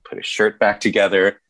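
ordinary talk

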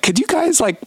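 high-pitched, imitated manager's plea; pleading manager. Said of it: "their manager was like".

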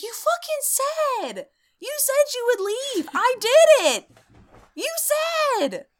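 high, loud mock ghost shrieking in outrage; exasperated ghost. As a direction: squeal it out like a betrayed ghost. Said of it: "And the ghost is like".

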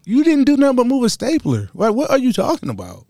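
teasing mockery. Teasing women after the mimicry.

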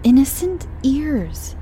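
high, slow and cross; mother scolding her lover, high and slow; crossly. Acted. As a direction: scold sharply, dragging it out. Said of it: "said Mummy crossly".

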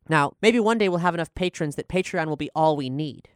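ordinary delivery

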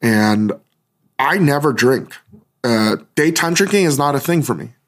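ordinary talk, nothing marked

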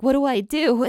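half-laughing imitation of someone panicking; mock freak-out. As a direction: act out a light-hearted freak-out. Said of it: "he is freaked out".

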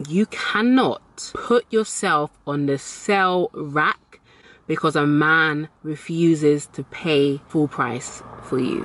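slow, deliberate, word by word; emphatic repeat. Shown as a demonstration. Telling expensive women again for emphasis, slow and emphatic. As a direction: spell it out slowly with spaces between phrases. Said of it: "I'll say that again".

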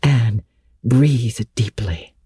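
usual speaking voice

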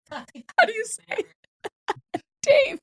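high, breathless laughing words. Real, not acted. Host to Dave after the cucumber clip, laughing. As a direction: barely get it out through laughter.

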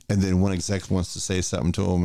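ordinary delivery

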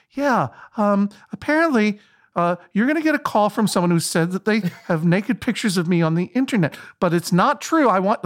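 high, faltering retold explanation; voice turning distressed. Caught passenger warning of a coming phone call, haltingly distressed. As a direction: imitate a caught man haltingly warning someone. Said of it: "his voice just started getting like".

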